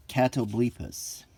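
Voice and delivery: ordinary talk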